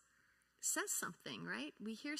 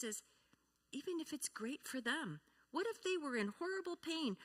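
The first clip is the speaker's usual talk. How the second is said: normal talking voice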